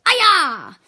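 loud high kiai; karate shout. Shown as a demonstration. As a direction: yell it like a strike. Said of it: "you say "Aya!".

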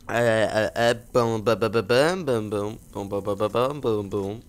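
loud, rapid "bam" noises; imitated gunfire. Gamer reloading his gun for fun, imitated gunfire. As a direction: mimic gunshots in quick bursts.

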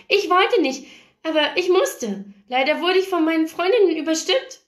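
put-on high, animated girlish voice; protesting girl. A narrator's reading. Paige excuses herself for coming, high protesting voice.

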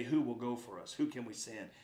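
low, soft question; hushed divine call. Acted. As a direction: voice God's call quietly and low. Voicing God's call to Isaiah.

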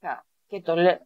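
ordinary delivery

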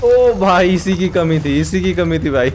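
high, loud burst of disbelief; sarcastic, worked-up gamer outburst; loud exasperated outcry. Real, not acted. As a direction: cry out at yet another threat. Dismayed at new game enemy.

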